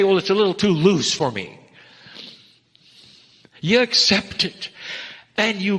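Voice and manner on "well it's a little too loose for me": mocking skeptic. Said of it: "You don't say".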